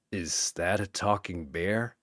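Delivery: deadpan disbelief under his breath; stunned archaeologist eyeing a talking bear; lightly voiced Indy. Indy reacts to the panda.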